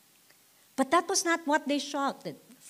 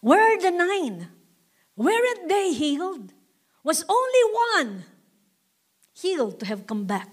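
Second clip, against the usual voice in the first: Jesus asking; 7 semitones higher, 3 dB louder than usual. Acted. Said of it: "look at him".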